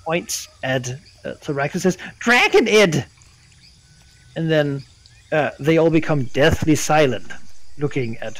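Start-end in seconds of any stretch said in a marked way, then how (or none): 2.2-3.0 high, loud kobold cry; screaming kobold. Acted. Kobold pointing at the dragon, high loud cry. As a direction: call it out high like an accusation.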